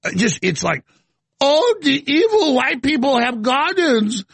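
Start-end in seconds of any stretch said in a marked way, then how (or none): 1.4-4.2 mocking whine. Mocking quote of activists.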